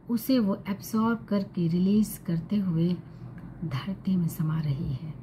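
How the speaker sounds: calm meditation guide, unhurried with long pauses; guided meditation. Acted. Guiding listeners to breathe and visualize purple light, calm meditation voice. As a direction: gently lead them through it, softening at phrase ends.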